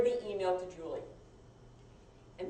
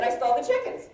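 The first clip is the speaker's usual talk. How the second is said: incredulous question; 5 semitones higher, 7 dB louder than usual. Retold or imitated. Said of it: "He said".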